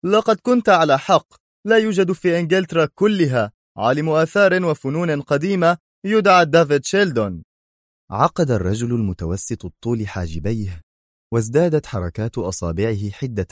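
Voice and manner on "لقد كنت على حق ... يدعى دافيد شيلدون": higher, louder irritated report; annoyed after a bad phone call; angry. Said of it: "وقال في غضب".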